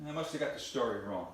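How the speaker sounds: normal talking voice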